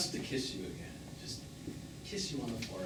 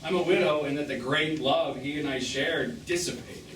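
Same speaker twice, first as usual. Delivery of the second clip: usual speaking voice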